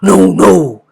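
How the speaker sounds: wolf stopping the bear; wolf warning. Acted. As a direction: stop him firmly. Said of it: "said the wolf".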